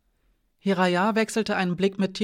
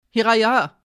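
loud call into the house past Timur; 4 semitones higher, 8 dB louder than usual. A narrator's reading. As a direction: call her name loudly and urgently. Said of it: "rief Alina an Timur vorbei ins Haus hinein". (laut ins Haus hineinrufen)

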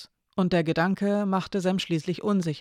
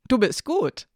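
pleased; 5 semitones higher, 3 dB louder than usual. A narrator's reading. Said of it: "freute sich Elena".

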